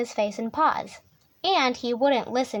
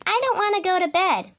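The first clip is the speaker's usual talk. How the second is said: high, stubborn cub voice; protesting Little Tiger; 7 semitones higher, 3 dB louder than usual. A narrator's reading. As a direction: refuse like a defiant child. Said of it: "said Little Tiger".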